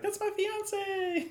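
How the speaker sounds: high, slow possessive shout; jealous wife. Retold or imitated. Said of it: "his wife was like".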